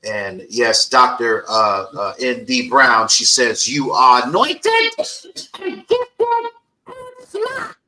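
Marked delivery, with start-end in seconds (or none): 3.7-7.8 high, slow, drawn-out sister impression; impersonating her sister. Host imitating her sister's praise in a high, slow voice. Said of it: "that was my impersonation of my sister".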